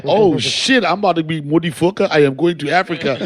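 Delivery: loud, dawning revelation, hammed-up; mock excited realization. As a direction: announce it like a sudden big idea. Acts out Dave's sudden realization.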